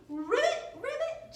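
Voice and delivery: second ribbit leaps way up high; squeaky rising frog call; frog croak. Retold or imitated. Teacher telling kids what frogs say, high squeaky ribbits. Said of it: "but most of them say".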